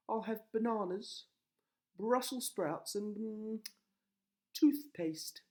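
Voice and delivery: high, loud dreamy wife. A narrator's reading. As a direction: list it in a high voice. Wife voiced in story.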